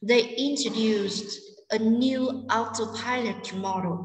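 slow repeat in two chunks, stressing "introduced", "new", "autopilot", "model"; sentence stress. Shown as a demonstration. Teacher repeating for students, slowly stressing "introduced", "new", "autopilot", "model". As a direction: pause after "introduced", then lean on "new", "autopilot" and "model". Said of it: "chúng ta sẽ nhấn trọng âm".

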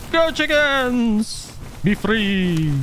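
high, long-stretched triumphant call. Real, not acted. Player setting the chickens loose, calling out. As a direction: send them off with drawn-out cries.

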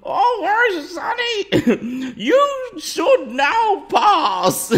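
high, slow, drawn-out old guy, hammed up; old man Santa. YouTuber playing Santa for viewers, high, loud, slow old-man voice. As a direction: wail it slowly like a scary old Santa, on the edge of laughing. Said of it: "what's an old guy sound like?".

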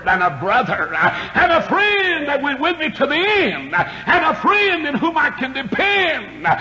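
rising and falling revival preacher, shouting peaks; fiery preaching. Acted. Revival preacher to his congregation, fiery preaching.

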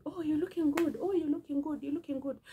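a lightly mocking cheerful greeting; imitating people's compliments. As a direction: mimic passersby cooing at her. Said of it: "people are telling me".

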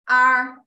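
R said with a curled tongue; R with the tongue rolled up. Shown as a demonstration. Teacher modelling R for students, tongue rolled up. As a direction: curl the tongue back for R. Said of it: "roll up your tongue".

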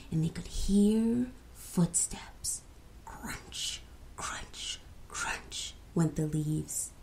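slow whispered suspense; storyteller whispering footsteps in the woods; spooky whisper. A narrator's reading. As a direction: hush it like a campfire tale.

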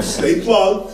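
usual speaking voice

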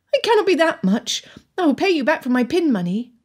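high-pitched, pressing Anne; insistent young Anne. Acted. Anne argues with her father.

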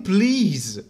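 normal talking voice

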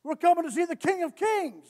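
loud, high preaching, fast and hard; full-force preaching. Acted. Pastor preaches to the church about standards, at full force. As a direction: drive it home from the pulpit.

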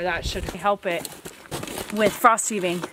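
normal talking voice

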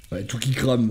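ordinary talk

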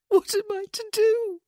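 loud, very high, tearful wail; sobbing. A narrator's reading. Widow asking friend for help, sobbing. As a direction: wail it helplessly through sobs. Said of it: "she sobbed".

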